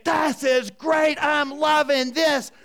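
high, loud, slow relish; acted delight. Grandpa driving the screaming kids home, loudly delighted.

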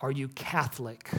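hushed, intense question, acted out; imitated intense questioning. Ugandan villagers questioning visiting missionaries at the door, quietly intense. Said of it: "they'd get real intense".

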